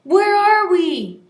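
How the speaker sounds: loud, slow panicked yell; shouted in a panic. A narrator's reading. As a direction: yell it right in his face. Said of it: "yelling in my face".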